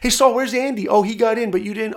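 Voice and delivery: high, loud needling classmate; taunting classmates. Retold or imitated. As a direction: rub it in with a loud high jeer. Classmates taunting him in the halls.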